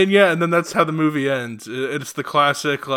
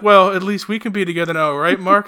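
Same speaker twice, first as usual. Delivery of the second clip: lightly voiced dying man; hammed-up tragic last words; mock Denny; 5 semitones higher, 6 dB louder than usual. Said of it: "he's just like".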